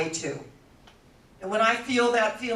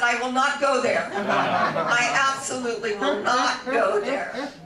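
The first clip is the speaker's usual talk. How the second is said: laughing refusal; 5 dB louder than usual. Retold or imitated.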